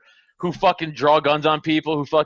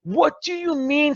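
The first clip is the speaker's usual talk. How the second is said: exasperated rant; 6 semitones higher than usual. Real, not acted. Ranting at Cuomo.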